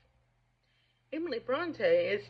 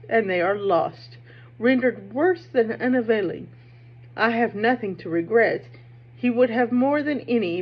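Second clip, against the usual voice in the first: Heathcliff reading; 9 dB louder than usual. Acted. Reading Heathcliff's speech aloud.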